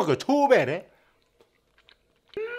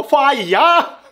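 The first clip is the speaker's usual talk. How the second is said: shouting; 5 semitones higher, 5 dB louder, much slower than usual. Acted. Shouted game call.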